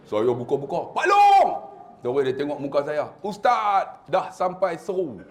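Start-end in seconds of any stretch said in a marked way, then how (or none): 0.9-1.5 high, loud shout of the name; calling out. Retold or imitated. Ustaz opening up to find Pak Long, surprised shout. 3.2-5.2 raised voice, drawn out at the end; mock announcement. Pak Long telling the Ustaz the call arrived, comic proclamation.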